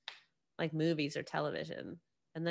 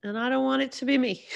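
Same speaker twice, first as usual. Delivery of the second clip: louder, higher insistent comeback; emphatic retort; 7 semitones higher, 7 dB louder than usual. Retold or imitated. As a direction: push back hard, voice up and loud. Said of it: "I'm like".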